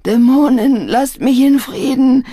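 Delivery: high, loud tormented outburst; raving. A narrator's reading. Opium smoker fights off his demons, raving. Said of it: "Er fing an zu toben".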